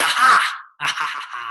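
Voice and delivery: loud triumphant aha, then a laugh. Retold or imitated. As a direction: caught it, crow with glee. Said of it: "And I was like".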